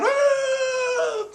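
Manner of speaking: high-pitched panicked scream; imitated shriek of terror; freaking out. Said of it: "Usopp's freaking out".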